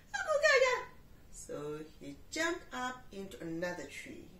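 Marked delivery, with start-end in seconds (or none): 0.1-0.9 much higher, loud monkey chatter. Acted. Monkey chattering as it swings in. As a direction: gibber like a giddy monkey.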